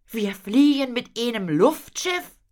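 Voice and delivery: put-on high, loud boyish excitement; amazed question. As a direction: ask it in thrilled disbelief. Boy amazed by the airship. (staunend, hoch und laut)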